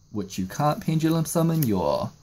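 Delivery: usual speaking voice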